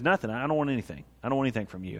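low, soft, understated refusal; quiet refusal. Retold or imitated. Elisha turns down Naaman's money, quietly dismissive. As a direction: wave the offer away quietly.

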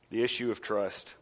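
soft, low repeat of the issue of trust; quiet repeat. Shown as a demonstration. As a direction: let the issue of trust land softly. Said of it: "What we're driving at here".

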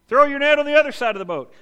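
loud, high call across the water to a boat; hollering. Retold or imitated. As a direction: shout it out from the shore. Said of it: "hollers out at Peter".

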